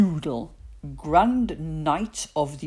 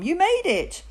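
high, cheering voice; excited high call; shouting; 14 semitones higher, 5 dB louder than usual. A narrator's reading. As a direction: cheer the boy on. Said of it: "shouted Mum".

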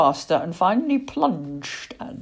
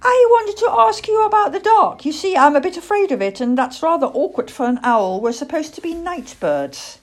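high, loud little-owl confession; pitched-up childlike voice, hurrying on; young owl Plop, earnest; 11 semitones higher, 6 dB louder than usual. Acted. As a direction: owlet blurting out his worry.

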